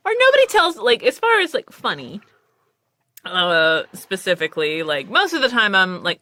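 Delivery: ordinary delivery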